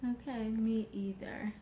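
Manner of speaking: an unvoiced, hushed aside; whisper. Real, not acted. Wife whispering back to husband's joke. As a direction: breathe it out as a whisper.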